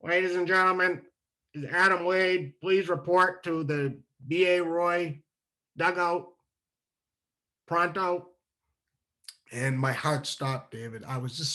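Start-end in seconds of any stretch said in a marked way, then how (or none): 0.0-8.3 PA announcer. Retold or imitated. Announcer over the PA calling Adam to the dugout, light mock announcer voice. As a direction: mimic the loudspeaker calling his name, unhurried. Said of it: "I hear on the P A system".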